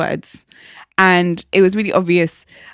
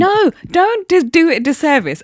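protesting; 9 semitones higher, 4 dB louder than usual. Real, not acted. Protesting a dismissive summary.

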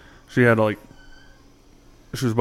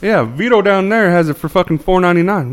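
higher-pitched mock Italian store owner; Italian accent; 8 semitones higher, 4 dB louder than usual. Liquor store owner quoting a rival's price, mock Italian accent. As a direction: put on an Italian shopkeeper's accent. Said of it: "I actually gave him an Italian accent".

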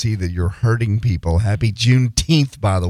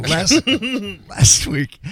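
laughing; 9 semitones higher, much slower than usual. Real, not acted. Laughing while reading intro.

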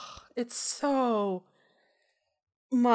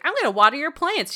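put-on bratty child; 5 semitones higher, 4 dB louder, faster than usual. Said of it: "She's like".

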